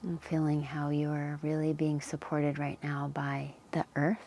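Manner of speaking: calm Yoga Nidra guidance with long pauses; unhurried, leave space between phrases to settle them in; guided meditation. Real, not acted. Yoga teacher settling listeners into the earth's support, calm guided meditation.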